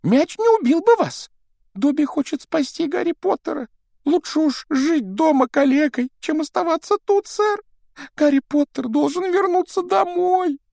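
high, squeaky pleading elf; house-elf voice. Acted. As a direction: play Dobby the anxious house-elf. Dobby pleads with Harry. (тонкий голос домового эльфа)

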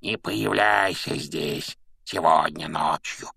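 slow, croaking ghostly order; low, drawn-out sinister croak; creaky rasp. A narrator's reading. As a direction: warn them off in a creaky ghost voice. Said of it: "проскрипел Гарри".

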